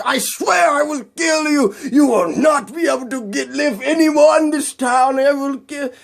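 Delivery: high-pitched mock-Russian rant; enraged villain. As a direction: rage on as a hammed-up villain. Villain keeps threatening Spider-Man.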